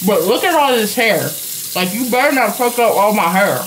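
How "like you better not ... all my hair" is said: mock warning.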